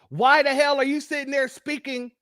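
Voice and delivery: normal talking voice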